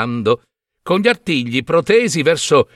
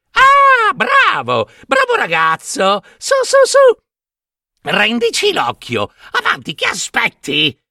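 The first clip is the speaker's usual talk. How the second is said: high screechy crones, urging; impatient hags. A narrator's reading. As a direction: squeal and hurry him along impatiently. Hags demand the eye back.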